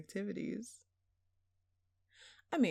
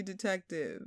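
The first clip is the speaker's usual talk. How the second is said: normal talking voice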